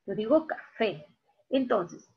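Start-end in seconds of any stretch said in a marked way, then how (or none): 0.5-1.0 "café" split as ca-FÉ, final syllable held; stress on last syllable. Shown as a demonstration. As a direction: demonstrate "café" with a drawn-out, stressed FÉ. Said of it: "está la mayor fuerza de voz". ("café" marcando la última sílaba: ca-FÉEE)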